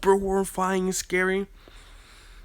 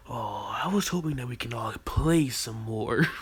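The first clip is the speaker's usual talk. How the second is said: maniacal taunt and laugh; 3 semitones lower than usual. Acted. Naruto smiling maniacally at his victims, then cackling. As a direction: gloat then burst into a mad laugh.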